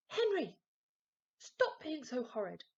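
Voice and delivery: high, loud scolding yell. A narrator's reading. Mum yelling at Henry to stop being horrid, shrill yell. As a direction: scream it at him, exasperated. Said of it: "yelled Mum".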